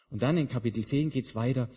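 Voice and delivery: normal talking voice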